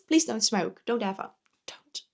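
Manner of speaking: ordinary delivery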